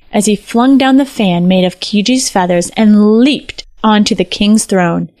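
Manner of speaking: ordinary delivery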